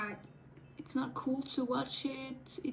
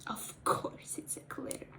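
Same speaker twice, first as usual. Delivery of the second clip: whispered aside; 8 dB quieter than usual. Real, not acted. Resigned aside.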